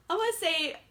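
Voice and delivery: usual speaking voice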